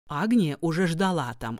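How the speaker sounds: ordinary delivery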